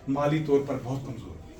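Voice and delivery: usual speaking voice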